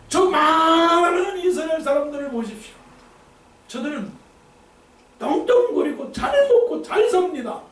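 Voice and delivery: high, pleading complaint to God, half laughing; a prophet wailing that he alone suffers; whining Elijah. Acted. Preacher voicing Elijah's complaint to God, high and whiny.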